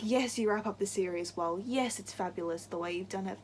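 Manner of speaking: ordinary talk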